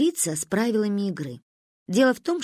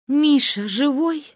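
slow, raised, choked question; strangled voice; 6 semitones higher, slower than usual. A narrator's reading. Mother fearing for Misha's life, strangled voice. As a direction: ask it slowly, throat tight. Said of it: "спросила сдавленным голосом".

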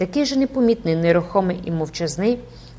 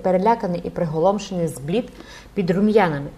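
ordinary delivery, nothing marked

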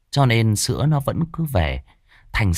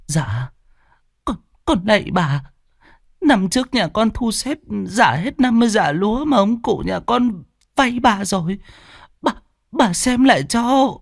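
high, begging voice, kneeling; pleading tremble; 12 semitones higher than usual. A narrator's reading. Servant kneels and begs the mistress, trembling. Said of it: "run rẩy quỳ xuống chắp tay".

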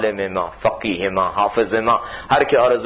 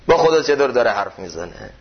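ordinary talk

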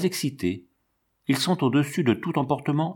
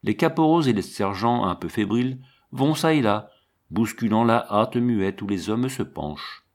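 plain reading voice

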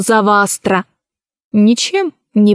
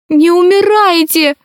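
crying plea; 7 semitones higher than usual. A narrator's reading. Alice begs the dying empress, tearful. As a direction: beg her not to die through tears. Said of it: "заплакала она".

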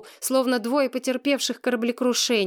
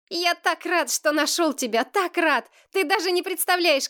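put-on high-pitched boy, earnest and delighted; joyful, higher child voice; overjoyed; 7 semitones higher than usual. A narrator's reading. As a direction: gush with relief at finding a friend. Boy hugs her, overjoyed.

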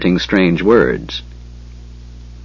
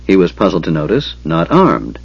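ordinary delivery, nothing marked

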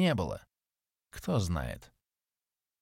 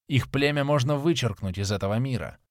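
ordinary delivery

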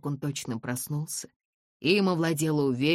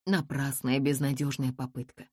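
plain reading voice

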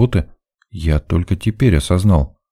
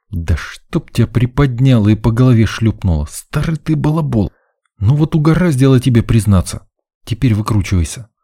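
higher, exasperated inner rebuke; self-scolding; 6 semitones higher than usual. A narrator's reading. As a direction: berate yourself with mild exasperation. Narrator scolds himself inwardly. (с досадой ругать самого себя)

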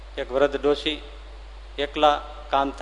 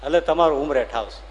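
normal talking voice

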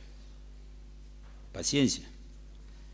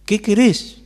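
louder and higher, QUERÉS driven hard; loud demanding question; 9 semitones higher, 9 dB louder, slower than usual. Acted. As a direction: shout the question at full force. Climax of Jesus' demanding question. (pregunta gritada, fuerte y aguda)

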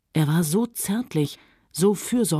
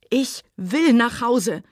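high, slow desperate cry; 6 semitones higher, slower than usual. Acted. Scarlett crying out to Rhett that she wants home. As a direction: cries it out, pleading to go home. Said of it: "rief sie".

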